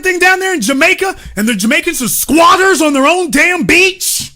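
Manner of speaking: high-pitched indignant rant; incredulous outrage. Real, not acted. Ranting to viewers about who owns the islands, incredulous outrage.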